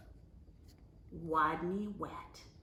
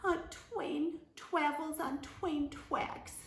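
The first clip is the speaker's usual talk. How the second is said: miserable; 8 semitones higher, slower than usual. A narrator's reading. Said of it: "Wadney replied miserably".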